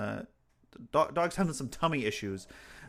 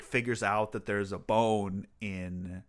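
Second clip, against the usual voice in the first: normal talking voice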